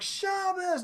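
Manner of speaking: high, sudden call; sudden shout. Retold or imitated. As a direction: burst in like a surprise. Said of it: "it's like".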